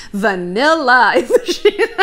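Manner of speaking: slowed, amused with a laugh; laughing through it. Real, not acted. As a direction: giggle as you say it. Joking about herself.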